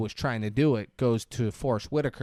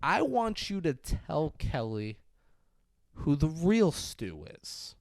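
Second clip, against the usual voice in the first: slow, deliberate, higher and softer villain demand; 3 semitones higher, 4 dB quieter, slower than usual. Retold or imitated. Villain orders Stu to confess to Kelly, slowly and deliberately.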